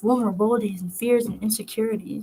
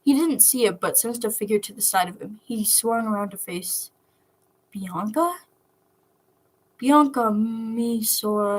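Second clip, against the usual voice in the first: plain reading voice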